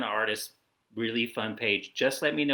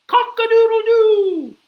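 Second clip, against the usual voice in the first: very loud, high crow; rooster crow; 15 dB louder than usual. Acted. Reader plays the rooster for kids, piercing crow. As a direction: crow at full blast, holding the last note. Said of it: "He can sound like a rooster".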